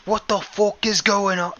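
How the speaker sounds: high, strained mock Boston accent; a hammed-up Boston accent. Talking swan in a Boston accent, high and hammed-up. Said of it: "Just the Boston accent".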